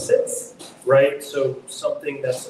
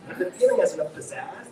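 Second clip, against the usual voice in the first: ordinary delivery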